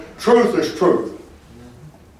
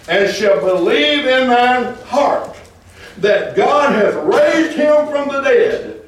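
high, slow, ringing proclamation; impassioned scripture preaching; 9 semitones higher, slower than usual. Real, not acted. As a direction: draw out each phrase, rising to exultation. Impassioned scripture reading, exclamation.

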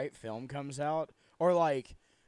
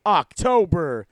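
spooky hype; 9 semitones higher, 11 dB louder than usual. Acted. Spooky announcement.

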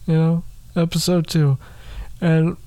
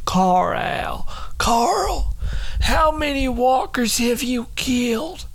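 put-on higher, wavering old lady call; quavering old voice; 6 semitones higher, slower than usual. Old resident calling Carl about walkers, high quaver. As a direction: call out like a trembling granny. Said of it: "calling Carl".

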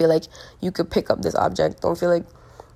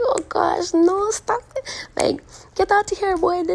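very high mock Trini protest; badly faked Trinidadian accent, squealed playfully; 13 semitones higher, 3 dB louder, slower than usual. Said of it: "The accent was so bad".

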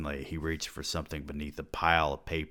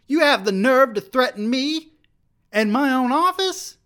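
outraged high shriek; 10 dB louder than usual. Acted. Bledsoe shrieking at the student who threatens him. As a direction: voice leaps very high and loud, strained.

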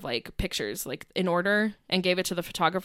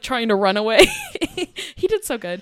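ordinary talk

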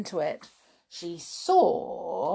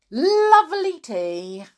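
relishing encouragement; 7 semitones higher, 7 dB louder than usual. Acted. Mister Cranky goads Grandma to drink.

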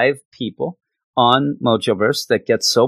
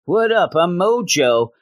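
higher, cocky Mojo greeting; 7 semitones higher than usual. Acted. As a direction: swagger in as the villain Mojo. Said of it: "he shows up and says".

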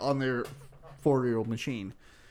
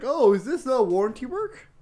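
higher, louder sing-song question; mocking customers; 9 semitones higher, 5 dB louder than usual. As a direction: play the customers as hopeful. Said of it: "they're like".

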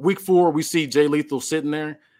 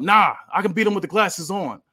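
higher, louder cocky yell; yelled boast; 6 semitones higher, 4 dB louder than usual. Acted. Wrestler refusing the ref, yelling back. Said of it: "Melo yells out".

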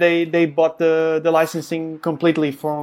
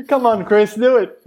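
higher and louder, cheering him on; excited urging; 6 semitones higher, 4 dB louder than usual. Real, not acted. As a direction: urge him on with real excitement. Cheering Nolan on.

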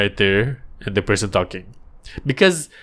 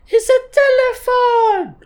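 very high, slow squeaky voice; squeaky cartoon voice; 4 dB louder, slower than usual. A cartoon sidekick answering the host, very high and squeaky. Kids' show dialogue, squeaky voice.

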